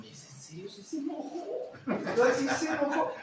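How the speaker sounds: high mock-surprised teasing question; mock surprise. Teacher teasing a student about the angry teacher, high mock surprise. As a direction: act amazed she got angry, teasing. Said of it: "y yo digo".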